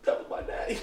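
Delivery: breathy, tearful whisper; choked whisper. Retold or imitated. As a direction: whisper it as tears come. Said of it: "[name] in the back like".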